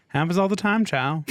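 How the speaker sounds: high, self-satisfied southern reply. A narrator's reading. Glover answers the stammered doubt, satisfied. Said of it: "he said with satisfaction".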